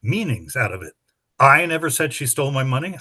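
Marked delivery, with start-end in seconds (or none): 1.4-3.0 emphasize I, then run through the rest; stress on I. Shown as a demonstration. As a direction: punch the opening word I. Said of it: "read that sentence with seven different emphases".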